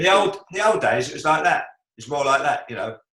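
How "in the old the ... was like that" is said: put-on higher, louder Caine voice, old days; Michael Caine impression. Shown as a demonstration. Demonstrating young Michael Caine's voice for the host, louder and higher. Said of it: "nasal quality it requires".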